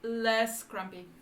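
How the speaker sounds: very slow, drawn-out words; word-by-word reading. Real, not acted. Translating for co-player word by word, very slow. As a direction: stretch out each word as you work it out.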